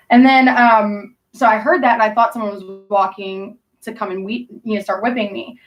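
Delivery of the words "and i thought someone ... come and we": usual speaking voice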